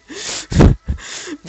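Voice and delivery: loud, high airy laugh; real laugh at the memory; breathy laugh. Real, not acted. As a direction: laugh it out without voice. Laughing at her own story.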